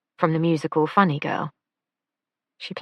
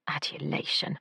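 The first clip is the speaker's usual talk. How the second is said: tutting; 10 dB quieter than usual. A narrator's reading. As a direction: scoff the word adulation back quietly. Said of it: "Lila tutted".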